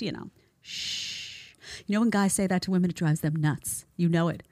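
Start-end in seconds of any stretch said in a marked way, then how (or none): 0.6-1.5 soft unvoiced shush. Shown as a demonstration. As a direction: hush her with a sharp shh. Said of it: "it's just".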